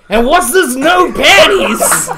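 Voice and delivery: loud, high, drawn-out demand; mock cop outburst. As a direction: blurt it out as a hammed-up accusation. Retold cop rushing the roleplay along.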